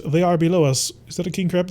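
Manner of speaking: normal talking voice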